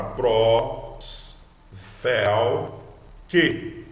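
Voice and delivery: slow "prós", "fel", "que" with pauses; syllables apart. Shown as a demonstration. Teacher breaks down compound word, syllables apart. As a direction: stretch and separate each part of the word. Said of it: "são as palavras compostas".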